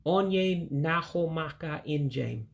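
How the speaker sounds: Onye Nahomaka Injem said slowly, each word clear; careful teacher pronunciation; slow Igbo demo. Shown as a demonstration. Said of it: "Let me say the whole thing out".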